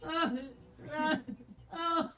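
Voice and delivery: straining under the weight, groaning; pained, effortful cries; strained groaning. Real, not acted. In pain under heavy partner.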